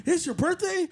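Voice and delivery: high, thrilled question about the birthday; a touch of the barber's giddy surprise; excited barber. Retold or imitated. Barber to customer, giddy surprise.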